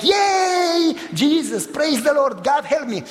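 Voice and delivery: high, ecstatic cry of praise; mock holy shout. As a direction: whoop it up like dancing a holy dance. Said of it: "You want me to shout and jump and sing and dance a holy dance like".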